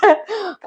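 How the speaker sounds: quick high laugh; a brief rueful laugh; short laugh. Real, not acted. Laughing at her own hardship while telling the interviewer.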